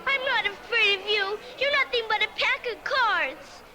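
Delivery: loud, high, brave defiance; defiant. Acted. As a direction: stand up to them bravely. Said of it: "mustered up all her courage".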